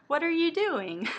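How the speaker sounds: high, loud startled cry; screamed protest. Acted. Shizuku screaming at the man about to kiss him, high loud cry. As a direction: yelp it out as he's grabbed. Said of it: "he screamed".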